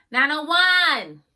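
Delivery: loud, high, drawn-out shout of the name; yelling. Real, not acted. Vlogger hyping the wig name to viewers, yelling. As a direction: shout the number out proudly. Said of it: "yes, I'm always yelling".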